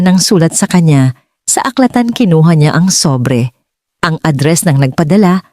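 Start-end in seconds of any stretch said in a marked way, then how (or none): none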